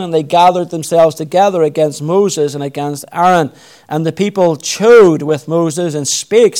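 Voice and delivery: measured, solemn Bible reading; scripture reading. Acted. Preacher reading Numbers to the congregation, solemn and measured. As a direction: read the King James passage steadily aloud.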